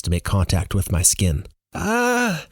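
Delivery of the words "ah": short high-pitched scream, held back in volume; startled high "ah". A narrator's reading. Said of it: "Lael screams".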